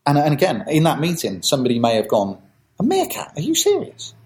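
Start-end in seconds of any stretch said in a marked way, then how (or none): 2.8-4.1 very high, disbelieving questions; mock-appalled squeaky disbelief; acted incredulity. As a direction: play a colleague scoffing at the idea, pitched right up. Acting out sceptic in pitch meeting.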